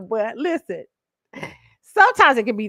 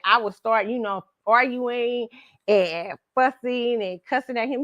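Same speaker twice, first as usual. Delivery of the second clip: ordinary delivery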